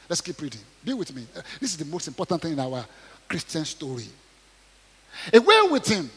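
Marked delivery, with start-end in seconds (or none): 5.3-6.1 high loud mob yell. Acted. Crowd yelling at Pilate to take Jesus, loud. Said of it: "They yelled".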